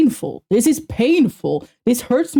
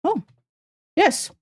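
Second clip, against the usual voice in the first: ordinary delivery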